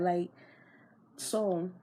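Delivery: ordinary talk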